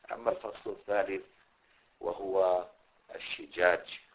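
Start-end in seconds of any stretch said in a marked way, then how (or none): 3.2-4.0 "الشجاج" said ash-shijaaj, with an i after the sh; "الشجاج" بكسر الشين; shin with kasra. Shown as a demonstration. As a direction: stress the kasra in "الشجاج", shi- not sha-. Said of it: "بكسر الشين".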